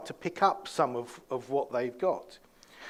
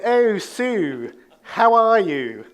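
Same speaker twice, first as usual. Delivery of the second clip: higher, louder posh lady; posh English accent; 5 semitones higher, 8 dB louder, much slower than usual. Retold or imitated. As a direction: greet Sue in an exaggerated posh English accent. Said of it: "They don't speak like that normally".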